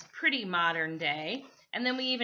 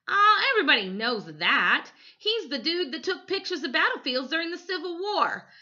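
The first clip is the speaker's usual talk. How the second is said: put-on know-it-all boy; 10 semitones higher, 7 dB louder than usual.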